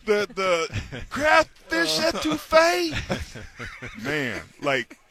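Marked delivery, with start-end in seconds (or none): 0.1-2.9 put-on Southern accent. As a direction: lay on a thick Southern accent. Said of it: "And the southern accent".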